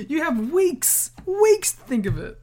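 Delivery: exasperated stretched weeks; stressed weeks. Real, not acted. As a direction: lean hard on weeks in disbelief.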